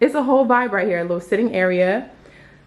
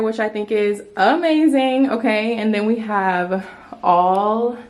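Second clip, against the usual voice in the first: usual speaking voice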